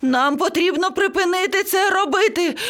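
very high squawking parrot panic; panicked shrieking. Acted. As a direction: screech in a frantic high voice. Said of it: "зарепетував папуга". (панічний вереск високим голосом)

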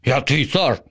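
boastful zombie king. A narrator's reading. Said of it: "запевнив Боба".

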